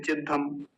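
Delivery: चिद्धम with ddh and no y glide; mispronunciation demo. Shown as a demonstration. Teacher quoting a common mistake, चिद्धम without the y. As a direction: drop the y, saying चिद्धम softly. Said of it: "कुछ लोग इसको चिद्धम बोलते हैं".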